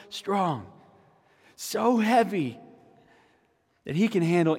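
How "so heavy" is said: normal talking voice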